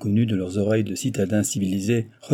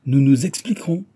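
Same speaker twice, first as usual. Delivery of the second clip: higher, louder threatening echo; menacing; 5 semitones higher, 6 dB louder than usual. A narrator's reading. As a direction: throw the words back as a threat. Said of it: "répéta mister Big d'un air menaçant". (d'un air menaçant)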